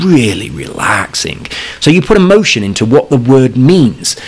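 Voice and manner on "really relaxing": slow, soft and relaxing; soothing. Shown as a demonstration. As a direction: ease into it gently. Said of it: "If I say relaxing".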